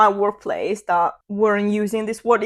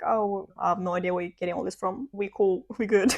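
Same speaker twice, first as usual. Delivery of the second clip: softer, casual shrug of a reply; unbothered siblings; 7 dB quieter than usual. Retold or imitated. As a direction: play it cool, nothing to worry about. Retold siblings unbothered by news.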